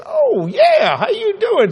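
very high, overfriendly greeting; feigned recognition. Retold or imitated. Preacher greeting a stranger on the phone, overfriendly. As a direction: squeal a fake-friendly hello.

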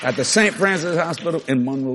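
ordinary talk, nothing marked

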